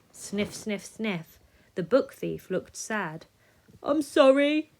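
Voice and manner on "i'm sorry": sniffled. Acted. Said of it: "he sniffled".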